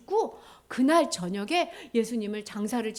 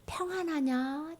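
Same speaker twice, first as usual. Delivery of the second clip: soft, slow, higher question; gentle greeting; 4 semitones higher, 6 dB quieter, slower than usual. Acted. Jesus asking us today, soft tender question. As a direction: ask softly, stretching the word. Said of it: "예수님 우리에게".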